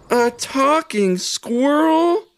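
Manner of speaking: high, slow, amazed question; astonished exclaim. A narrator's reading. Ivy jumps back from the talking squirrel, astonished. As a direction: stretch out talking squirrel in disbelief, pitched high. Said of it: "exclaimed Ivy".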